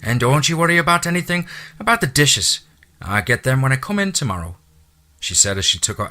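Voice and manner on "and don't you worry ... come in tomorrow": high, loud Irish housekeeper voice; chatty Irish brogue, pitched high. Acted. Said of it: "her lyrical accent".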